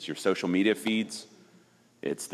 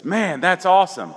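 high, loud fake praise before smashing it; loud, high "that's awesome" from a prankster; mock enthusiasm; 12 semitones higher, 7 dB louder than usual. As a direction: sell it like a genuine compliment, loud and high. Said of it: "and he goes".

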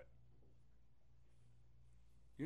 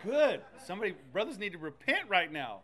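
much louder, higher excited shout; fired-up amen; 6 semitones higher, 14 dB louder than usual. Retold or imitated. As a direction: cheer on the preacher. Congregant cheering prophetic sermon.

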